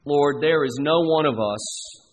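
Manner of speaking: slow, reverent prayer voice with long pauses; closing prayer. Acted. Pastor leading the congregation in prayer, slow and reverent. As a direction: lift it up to God, unhurried.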